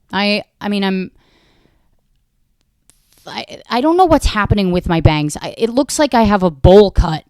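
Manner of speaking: ordinary delivery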